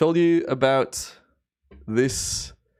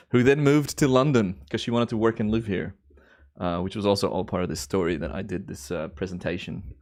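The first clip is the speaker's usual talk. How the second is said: ordinary delivery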